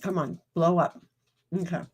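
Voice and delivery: ordinary talk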